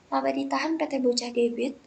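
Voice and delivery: ordinary talk, nothing marked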